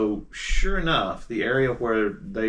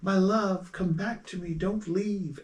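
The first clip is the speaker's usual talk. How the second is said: a hint of a pleading ghost, higher; lightly voiced mournful husband; ghostly man's voice; 9 semitones higher, slower than usual. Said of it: "this man's voice saying".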